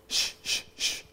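repeated unvoiced shh; shushing sounds. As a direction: hiss a run of shushes. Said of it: "the more they sh".

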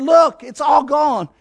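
slow, loud cry of wonder; joyful shout. Acted. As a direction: call it out amazed, drawn out. Leper shouting at healing.

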